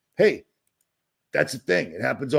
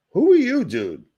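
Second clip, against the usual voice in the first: high, loud mocking of a nosy commenter; 10 semitones higher, 4 dB louder than usual. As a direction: parrot the nosy question in a high voice. Mocking a commenter asking who he is.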